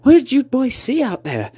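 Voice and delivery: high-pitched fretting aunt voice; panicking aunt. Acted. Aunt asking the boys what happened, panicking. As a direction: question the boys in high alarm. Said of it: "She panics".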